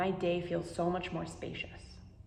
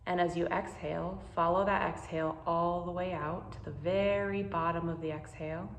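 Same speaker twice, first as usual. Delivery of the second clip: guided meditation; 5 dB quieter than usual. Acted. Teacher guiding listeners through a pause practice, calm and unhurried. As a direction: ease the listener into calm, one breath at a time.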